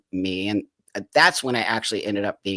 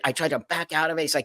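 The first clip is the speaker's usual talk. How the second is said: ordinary talk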